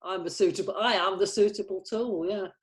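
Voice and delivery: ordinary talk, nothing marked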